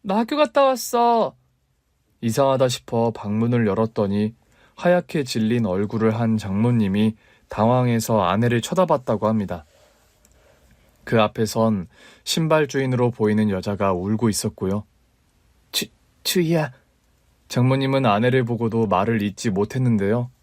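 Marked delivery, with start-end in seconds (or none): 0.0-1.3 put-on high childlike call for mom; a young girl calling out as she comes home; little girl calling home. A narrator's reading. 학교에서 돌아온 어린 딸이 엄마를 부르며, 높은 아이 목소리로. Daughter calling mother after school. 15.7-16.7 halting stammer, breath caught; a mother caught off guard, stumbling on her daughter's name; stammering shocked mother. A narrator's reading. Mother stunned to see her daughter, stammering her name.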